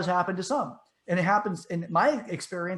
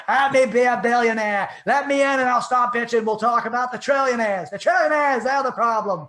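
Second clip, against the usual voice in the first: hammed-up shouting to be let in; loud, high senator impression, as if beating on a door; Bernie Sanders; 6 semitones higher, 8 dB louder than usual. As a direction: plead and rail at full volume in a mock politician voice. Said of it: "he's just outside beating on the door going".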